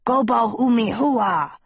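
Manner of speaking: high-pitched goblin babble; goblin gibberish. A narrator's reading. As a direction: say it as furious nonsense in a goblin tongue. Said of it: "To everyone else, this sounded like".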